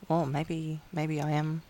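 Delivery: softly voiced dawning self-doubt; hesitant self-questioning. Gaslit partner starting to question themselves, hesitant and quiet. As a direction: wonder it quietly, with halting pauses. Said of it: "you kind of start to question".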